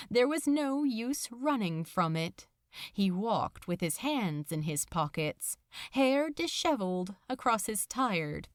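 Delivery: ordinary delivery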